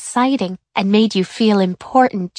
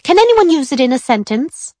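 higher, louder teacher asking; 6 semitones higher, 4 dB louder than usual. A narrator's reading. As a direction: ask the whole class brightly. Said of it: "Missus Dirkman said".

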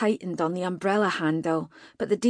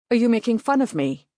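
angry accusation; 4 semitones higher, 6 dB louder, faster than usual. Acted. Mage suspects mockery.

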